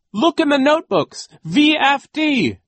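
very high loud scream, letters dragged out slowly; desperate scream. A narrator's reading. Duncan screaming to Klaus before his mouth is covered. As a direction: scream the last clue before being silenced. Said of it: "Duncan screamed".

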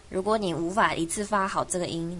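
ordinary talk

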